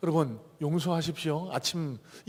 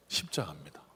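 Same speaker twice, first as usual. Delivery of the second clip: a still softer whispered repeat of 십자가입니다; 더 작은 속삭임; 9 dB quieter than usual. Acted. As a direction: let it fade into a whisper. Repeated hushed answer.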